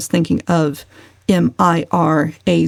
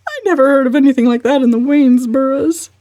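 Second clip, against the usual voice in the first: fast, higher, louder sobbing protest; 8 semitones higher, 4 dB louder, faster than usual. Acted. Mother defending her family, sobbing. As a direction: blurt it out through sobs. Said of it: "she sobbed".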